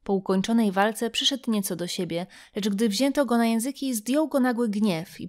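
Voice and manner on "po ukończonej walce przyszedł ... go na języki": ordinary delivery, nothing marked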